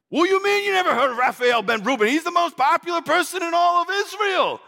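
high, quick disbelief at the audience; incredulous. Acted. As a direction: act shocked at their ignorance.

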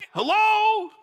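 high, drawn-out incredulous call; indignant "are you serious" tone; exasperated. Acted. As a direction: call it out as if nobody gets the obvious. Exasperated at giving to poor.

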